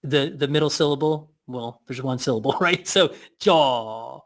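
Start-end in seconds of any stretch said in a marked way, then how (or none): 3.4-4.2 drawn-out jaw. Shown as a demonstration. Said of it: "allow that word to elongate".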